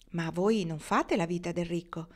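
plain reading voice

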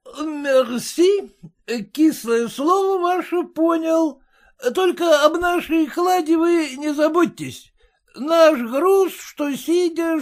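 put-on high, slurred drunken purr. A narrator's reading. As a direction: purr it out tipsy. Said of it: "мурлычет пьяным голосом".

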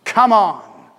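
sudden forceful cheer; rallying shout. Acted. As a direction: spur a struggling friend on. Urging listeners to spur others on.

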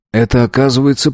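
ordinary delivery, nothing marked

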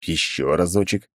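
ordinary delivery

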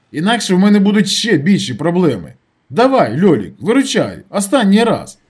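high, loud persuasion; urgent pleading. A narrator's reading. Narrator asking Lyolik to bail him out, pleading urgently. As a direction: push hard for a favour. Begging a friend for money.

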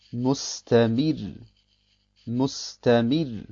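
Mustamir langsam, mit hellem R am Ende; helles Ra. Shown as a demonstration. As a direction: lass das R in Mustamir hell ausklingen. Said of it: "dann wird das Ra hell ausgesprochen". (Mustamir gedehnt, End-R hell)

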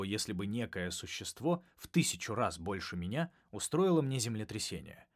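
plain reading voice

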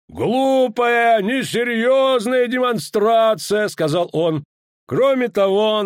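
high, loud, slow contempt; stern commander. A narrator's reading. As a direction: scold them coldly from above. Danza scolding from gallery. (презрительный командир)

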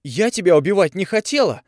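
high, loud scream cracking upward; girl shrieking at him; yell breaking into a shriek. A narrator's reading. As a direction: scream it till it squeals. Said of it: "заорала Тави, срываясь на визг".

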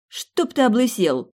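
loud, slow heartfelt curse; fervent curse. Acted. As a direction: hurl the curse with passion. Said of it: "пожелала я от чистого сердца".

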